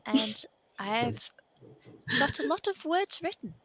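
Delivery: put-on a rather delightful old wizard, hushed; posh wizard from the D and D game. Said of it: "I'll just put my wizard voice on".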